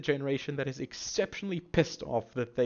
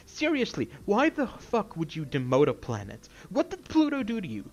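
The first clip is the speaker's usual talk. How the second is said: high-pitched, exasperated ranting; worked up, pitch jumping high; angry rant; 4 semitones higher than usual. Real, not acted. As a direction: demand an answer in outrage. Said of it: "I did not expect to get this angry".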